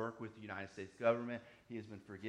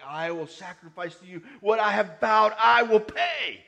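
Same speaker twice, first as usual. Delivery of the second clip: loud, raised declaration from scripture; emphatic vow; 8 semitones higher, 18 dB louder than usual. Acted. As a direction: proclaim the vow at full preaching force. Preacher reads a vow with force.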